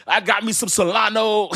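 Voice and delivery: loud, high mock boast; hammed-up, high-pitched bragging; imitating proud followers. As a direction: crow it like a clueless new fan. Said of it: "y'all gonna be walking around talking about".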